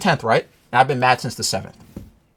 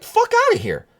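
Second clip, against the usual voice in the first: dismissive retort. Retold or imitated. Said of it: "And I'm like".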